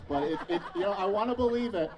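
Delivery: loud, laughing confession; chuckling at himself while admitting it. Real, not acted. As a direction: laugh through it, half-rueful. Laughing about wife's compliment.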